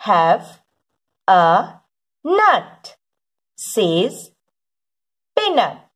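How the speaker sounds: deliberate early-reader storybook delivery, falling on each last word; slow word-by-word reading. Acted. Teacher reads to children following along, slow word-by-word reading. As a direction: read each word separately with long pauses.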